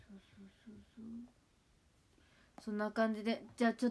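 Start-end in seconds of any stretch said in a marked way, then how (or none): 0.1-1.1 whispered, much softer agreement. Real, not acted. Quietly agreeing to herself, whispered. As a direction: breathe the agreement almost silently. Muttered agreement near stream end.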